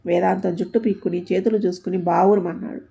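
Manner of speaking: usual speaking voice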